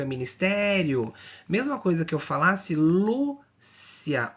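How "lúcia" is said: "Lúcia" slowly split as Lú, cia; syllable separation. Shown as a demonstration. Teacher giving another example to students, "Lúcia" broken into two slow syllables. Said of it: "da separação silábica".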